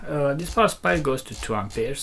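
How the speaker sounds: ordinary talk, nothing marked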